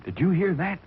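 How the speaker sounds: ordinary delivery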